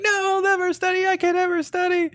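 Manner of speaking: very high, quick squeal of refusal; high-pitched cartoonish protest; mock whining protest. Acting out Olivia's protesting refusal.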